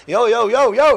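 loud, repeated yo in alarm; alarmed calling. Acted. Driver to collapsing passenger, alarmed shouting. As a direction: call out to rouse someone.

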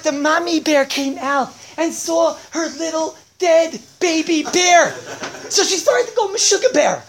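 high, loud mock-tragic tirade; hammed-up upset rant. Storyteller recounts the bear family's massacre, hammed-up hysteria. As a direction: build to hysterical shouting at each new death. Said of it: "I don't usually get so upset".

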